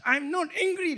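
high, indignant denial; angry denial. Acted. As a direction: deny being angry while clearly sounding it. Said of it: "the tone of voice".

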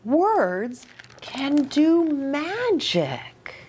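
slow, knowing owl voice; hint that words are magic, slowly; wise owl. A narrator's reading. Storyteller voicing the wise owl, slow and knowing. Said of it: "said the owl".

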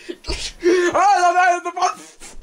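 straining. Pretend. Pretend Force struggle.

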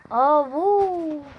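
high, loud startled shout; startled cry. Real, not acted. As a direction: yelp in sudden shock. Sudden startle in game.

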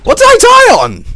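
shrill cartoon squeak, laughing; squeaky high mock voice. Gamer mocking a dead worm in a tiny squeaky voice.

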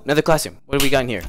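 ordinary talk, nothing marked

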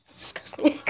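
hushed whispered aside; whispering. Real, not acted. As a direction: whisper it under your breath.

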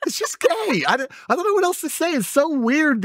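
high mocking voice; mocking falsetto. Real, not acted. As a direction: keep the mocking pitch way up.

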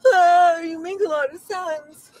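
wailing mock cry. Acted crying breakthrough.

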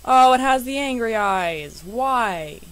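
loud, slow, drawn-out lament; dismayed wail. Real, not acted. Player seeing the pet's unwanted eyes, loud dismayed wail. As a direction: wail it out in playful despair.

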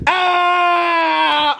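long, high scream; pained wail. Pretend. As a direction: hold a high scream as if hurt. Hurt again in staged scuffle.

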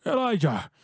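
high call after someone leaving. Acted. Tremor calling after Elijah as he storms out, high call. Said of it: "he calls out to me".

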